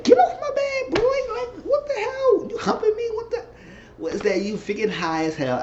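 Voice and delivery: squeaky, laughing high-pitched scold; high mock shriek. Imitating her ordering him off the bed, laughing high shriek.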